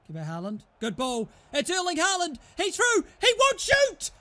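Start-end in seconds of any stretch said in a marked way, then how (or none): 1.5-4.1 climbing higher and louder, then exasperated; rising excitement to outrage. Real, not acted. Gamer watching his striker through on goal, building excited yell. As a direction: build the hype then snap into disbelief.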